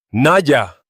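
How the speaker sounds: high, loud shout of a name; desperate cry. A narrator's reading. Luke calls to Naya as the river takes her, desperate shout. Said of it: "El grito se perdió".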